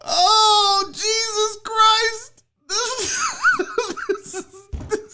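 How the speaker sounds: very high, slow squeal through tears; real helpless crying laughter; laughing to tears. Real, not acted. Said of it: "I'm crying".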